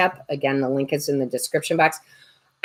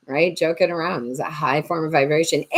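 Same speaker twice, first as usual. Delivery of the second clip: usual speaking voice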